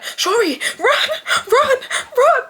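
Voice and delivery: high-pitched mock shout to run; urgent calling out. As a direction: urge a little sister on, high and urgent. Urging sister on during chase.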